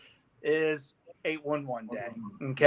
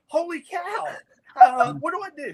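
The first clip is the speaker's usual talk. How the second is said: mock panic; 10 semitones higher, 5 dB louder than usual. Retold panic while driving.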